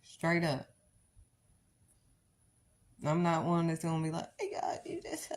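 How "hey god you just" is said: whiny high whisper, playing the victim; breathy self-pitying plea to God, mocked; mock victim prayer. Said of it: "that victimized stuff".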